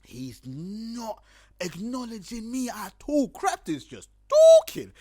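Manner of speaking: slow, high, drawn-out complaint building to a shout; hammed-up exasperation; exasperated. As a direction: stretch every word in mock disbelief, then burst out loud. Exasperated at being ignored.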